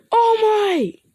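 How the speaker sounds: very high, loud happy shout; joyful cry. Acted. Midoriya running to hug All Might, joyful high shout. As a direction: cry out in relief and joy.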